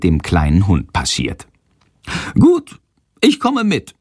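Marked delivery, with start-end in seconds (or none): 2.3-3.9 small bat agreeing, pitched very high. Acted. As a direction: give in with a high squeak. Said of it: "sagt er schließlich".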